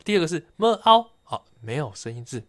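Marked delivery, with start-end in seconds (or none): 0.6-1.1 么 and 凹 said as separate, higher-pitched sounds; sounded-out syllable. Shown as a demonstration. Podcaster demonstrating the second syllable, sounding out 么凹. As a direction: sound out 么, then 凹, piece by piece. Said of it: "它的发音呢，其实是这样的".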